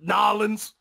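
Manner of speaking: put-on Cajun accent. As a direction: imitate a Cajun friend saying "Nolans". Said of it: "he's like".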